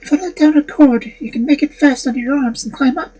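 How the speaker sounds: high, anxious maiden. Acted. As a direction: lift to a high young woman's voice. Maiden speaks.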